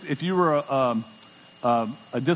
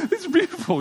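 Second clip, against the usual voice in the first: much higher, teary-laughing gush; choked-up gush. Retold or imitated. Pastor gushing over his children's baby pictures, high and teary. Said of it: "getting all, you know, wound up".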